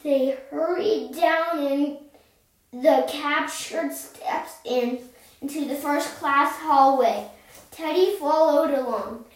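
usual speaking voice

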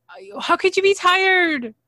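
high, loud whiny protest; mock Darcy. Mimicking Darcy pestering tired Florian, high and loud. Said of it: "Florian does not stop".

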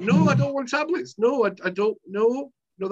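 indignant refusal of tablets. Retold or imitated. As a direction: push back hard on the tablets.

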